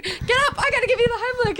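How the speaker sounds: fast, high urgent command. Retold or imitated. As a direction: rush it like a panicked friend. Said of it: "Emily went".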